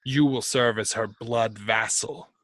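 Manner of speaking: bind him with each phrase; commanding dark lord. Acted. Posing as a tyrant god, binding a servant to another, commanding.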